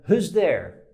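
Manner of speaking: "Who's there?" called a bit loud; calling through the door. Shown as a demonstration. Someone at the door calling out about a noise. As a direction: call out toward the noise at the door. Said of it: "E pergunta".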